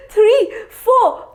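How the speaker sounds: ordinary delivery, nothing marked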